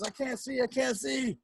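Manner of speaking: a hint of a flustered rabbit who can't see; blinded rabbit. Rabbit covering her eyes, lightly voiced flustered cry. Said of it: "she says".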